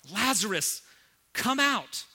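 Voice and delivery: loud, high summons; commanding call. Acted. Preacher repeats Jesus's call to Lazarus, high loud summons. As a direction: ring it out to the grave. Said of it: "repeats the command".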